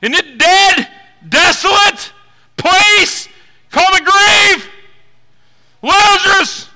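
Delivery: loud, high, drawn-out preaching; preaching at full force. Real, not acted. Preacher telling the church about Lazarus, shouted preaching. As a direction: proclaim each word like a trumpet.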